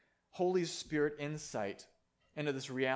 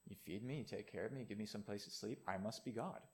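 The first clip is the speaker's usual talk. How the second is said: smug cat; 6 semitones lower, 11 dB quieter than usual. Retold or imitated. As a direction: lower and softer, a self-satisfied cat. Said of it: "And the cat says".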